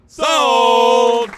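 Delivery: loud auctioneer shout; shouted sold. Acted. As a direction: bang it out like a gavel. Auctioneer closes the sale.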